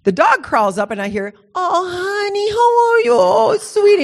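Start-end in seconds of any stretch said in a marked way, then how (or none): 1.5-4.0 put-on very high, drawn-out baby-talk; mock sugary doting voice, pitched way up; cooing to a pet. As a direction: imitate someone fussing over a dog in high sing-song. Husband cooing to the dog.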